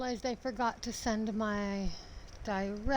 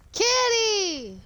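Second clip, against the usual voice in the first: high, loud delighted squeal; excited; 9 semitones higher, 7 dB louder than usual. Real, not acted. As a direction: call out to a kitty in delight. Spots a cat in game.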